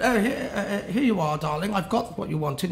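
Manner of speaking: mock posh English, starting high. Hooligan offers coleslaw to the girl, put-on posh voice. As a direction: play a hooligan feigning posh manners. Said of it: "first he was posh".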